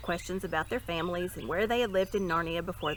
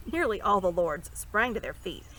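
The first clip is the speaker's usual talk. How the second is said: plain reading voice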